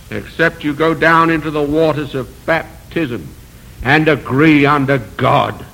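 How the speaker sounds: normal talking voice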